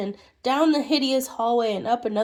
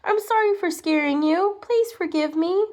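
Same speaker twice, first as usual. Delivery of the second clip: higher, timid apology; shy squeaky little man; 7 semitones higher than usual. Acted. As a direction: squeak it out shyly. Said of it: "shy, squeaky voice".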